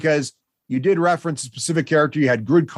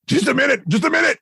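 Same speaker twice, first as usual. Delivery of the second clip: nervous goblin; 10 semitones higher than usual. Acted. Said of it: "He's like".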